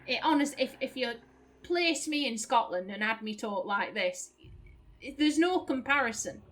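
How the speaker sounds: broad Lancashire, often mistaken for Scottish; natural Lancashire accent. Real, not acted. Streamer insists there's no comparison with Scots, broad Lancashire accent. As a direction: talk in broad northern English from Lancashire. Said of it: "This sounds now like Scottish accent".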